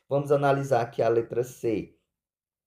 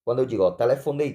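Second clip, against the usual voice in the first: normal talking voice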